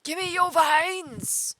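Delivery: high, slow, shrill-free cartoon alien demand; Zim voice. Streamer plays greedy cartoon alien, Zim voice. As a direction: squeal it like Invader Zim. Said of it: "just doing the Zim voice".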